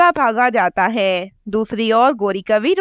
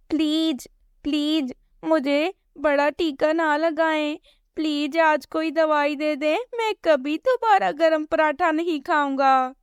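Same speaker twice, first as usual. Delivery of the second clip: soft, slightly raised pleading; a hint of a scared little bird pleading; begging chick; 5 semitones higher, 5 dB quieter than usual. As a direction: beg to be spared the shot. Chick begs not to get injection.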